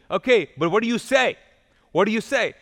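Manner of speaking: mock parental nudge; insistent mom. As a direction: press the child for the magic words. Mom insisting child say thanks.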